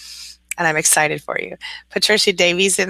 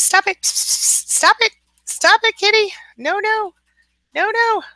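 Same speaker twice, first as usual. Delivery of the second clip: squeaky, much higher "stop it" aimed at the kitten; high-pitched scolding of the kitten; slower than usual. Real, not acted. Host scolding her kitten off the office stuff, high-pitched scold. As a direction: shoo the kitten off in a high sing-song scold.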